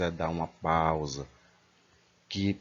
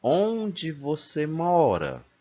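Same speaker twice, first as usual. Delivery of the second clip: very slow, much higher questioning tune; question intonation; 8 semitones higher, much slower than usual. Shown as a demonstration. Teacher showing pupils how questions sound, slow high tune. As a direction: lift the whole question up, drawn out. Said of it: "já tem que ser de quem tá perguntando alguma coisa".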